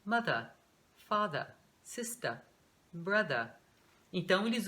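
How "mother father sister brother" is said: British "mother, father, sister, brother", each ending in an open uh without r; British pronunciation. Shown as a demonstration. Teacher showing how the British say these words, British r-less endings. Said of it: "eles falam".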